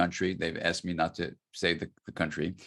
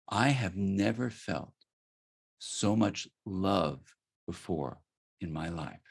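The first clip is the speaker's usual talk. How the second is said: slow, softer, a touch of held-back tears; quiet and tearful; slower than usual. Retold or imitated. Politician confiding his moved experience to the speaker, slow and quietly tearful. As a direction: let the words come slowly, close to tears. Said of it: "he gets really quiet and tears are coming".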